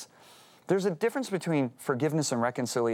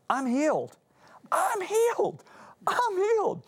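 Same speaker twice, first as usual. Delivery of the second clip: each I'm healed climbing much higher, voice breaking; rising cry of joy; slower than usual. Retold or imitated. Reliving the moment he felt healed, breaking into tears. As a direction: build to a tearful, soaring cry.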